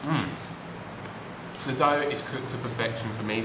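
eating between words; talking while chewing. Real, not acted. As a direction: mouth partly full of food. Seen 0: Bites calzone at -3; lips pursed chewing early, fork at mouth.

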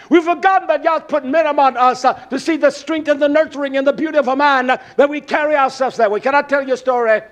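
loud, high, fired-up sermon cadence; full-force preaching. Acted. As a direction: preach it hard at the congregation.

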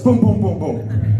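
rapid-fire booms for blows landing; imitated smacks. Preacher mimics dad's backhand hitting all the kids, punchy booms.

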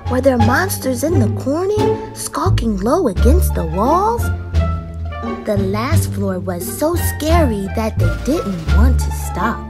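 plain reading voice

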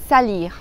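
"salir" repeated with the French R; "salir", French R, never rolled. Shown as a demonstration. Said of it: "la R del verbo salir en francés se pronuncia como una J".

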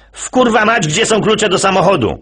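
high, exasperated swearing; angry parent. Acted. [name] playing angry dad hunting for keys, high voice. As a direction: rant up high like a frantic dad.

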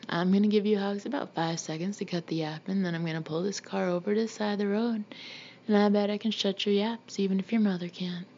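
ordinary delivery

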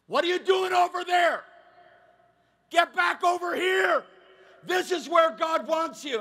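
loud, high pulpit shouting; shouted rebuke, slowing as it lands; revival preacher. Acted. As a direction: holler commands like a visiting revivalist. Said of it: "the revival preacher shows up and goes".

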